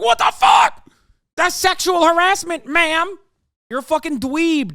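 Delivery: high, loud mocking whine of an offended bro; mock outraged incel. Streamer mocking incels who cry harassment, high mock shout. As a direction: shout it up high like a caricature whining about harassment.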